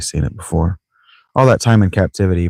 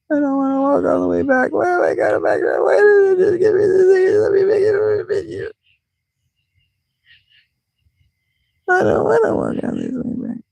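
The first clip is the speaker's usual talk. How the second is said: very high squeaky whine; exaggerated high moaning protest; pissing and moaning. Real, not acted. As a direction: whine in a tiny high-pitched voice. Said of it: "My pissing and moaning".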